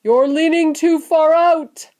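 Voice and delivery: high, loud, slowed warning; drawn-out warning yell; shouted warning. A narrator's reading. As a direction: shout it out to your son in alarm. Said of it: "shouted Mister Gloop".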